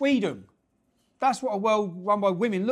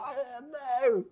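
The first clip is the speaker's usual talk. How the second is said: soft, higher mock dismay; imitating the lady; 6 semitones higher, 6 dB quieter than usual. The Bake Off tweeter realizing her spoiler, mild mock dismay. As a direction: voice her cringing embarrassment lightly.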